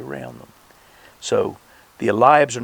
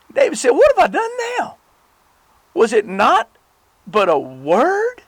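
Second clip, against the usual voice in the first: much higher, pleading and baffled; exasperated younger brother; 4 dB louder than usual. Acted. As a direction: protest your innocence, exasperated. Reading David's reply.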